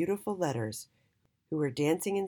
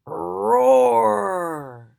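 loud booming growl of a stomach; tummy roar; 4 semitones higher, 12 dB louder than usual. Acted. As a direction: roar it out like a hungry belly. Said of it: "came the sound from Mister H's tummy".

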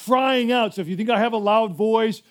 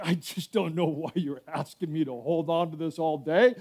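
grumbling quietly; 6 semitones lower, 7 dB quieter than usual. Retold or imitated. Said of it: "You're like".

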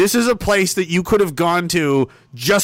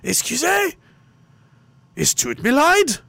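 mock confused French; 3 semitones higher than usual. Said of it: "I would say".

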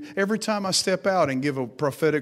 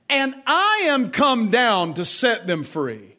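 higher, slow proclaiming over music; preaching; 6 semitones higher, 3 dB louder, slower than usual. Acted. Preacher voicing God over music, higher and slow. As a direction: declare God's promise slowly.

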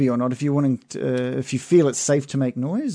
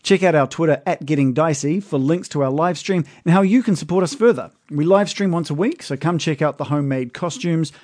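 upbeat, louder promo read; podcast ad read; 3 semitones higher, 5 dB louder than usual. Acted. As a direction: read the plug brightly. Reads the podcast outro.

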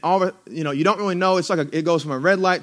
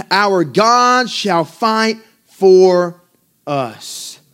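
slow, loud build that drops away; slow swell to a hushed finish; preached scripture climax; 4 dB louder, much slower than usual. Acted. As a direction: stretch it out then let it fall. Closing line of scripture.

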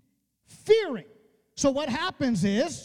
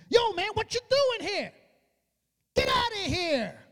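retold angry yelling; 7 semitones higher than usual. Older brother confronts David at the battlefield, mock yelling. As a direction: imitate an indignant brother telling someone off. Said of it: "he starts yelling at him".

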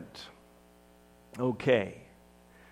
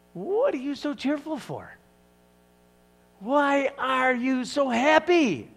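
miffed; 8 semitones higher than usual. Acted. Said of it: "a little bit miffed".

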